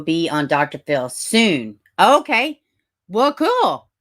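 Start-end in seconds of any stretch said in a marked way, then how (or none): 2.0-3.9 surprised. Real, not acted. Reacting to news from chat.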